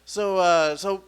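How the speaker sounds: normal talking voice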